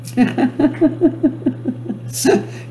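a high, airy laugh with no voice; breathy laugh. Shown as a demonstration. Host laughs along, showing viewers how. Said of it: "So I command you to laugh right now".

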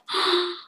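sharp high gasp of shock; mock startled gasp. Shown as a demonstration. Filter demo, shocked reaction. (gulat na hingal)

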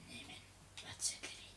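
whispered curious question. Real, not acted. Gamer sneaking through a room, whispering.

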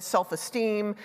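ordinary delivery

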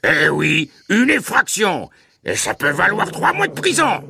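very high, strained guard's voice; croaky shout. A narrator's reading. Guard threatens trespassing family with prison, croaky shout. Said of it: "cria soudain une voix graillonneuse".

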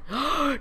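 sharp, high gasp of delight; sudden thrilled intake of breath; excited gasp. Real, not acted. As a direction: gasp at a lucky find. Spots diamonds in the game.

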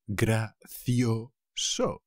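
Gracioso stretched very slow, syllable by syllable; very slowly drawn out. Shown as a demonstration. Teacher drawing Gracioso out for students. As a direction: spell out Gracioso slowly for learners. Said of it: "teacher models the word very slowly".

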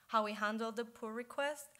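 normal talking voice

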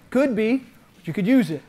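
normal talking voice

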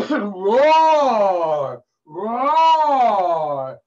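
loud, high, long-drawn roars; roaring lion. Acted. Storyteller plays the lion caught in a trap, roaring loudly. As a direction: roar like a trapped, angry lion.

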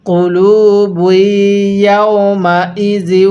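কুলুবু ইয়াউমা ইজি drawn out and chanted; slow Quranic recitation. Shown as a demonstration. Teacher reads the spelled phrase back slowly. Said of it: "কিভাবে পড়ব?".

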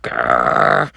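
low snarling dog growl. Acted. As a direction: growl like an angry police dog. Angry dog growls at villain.

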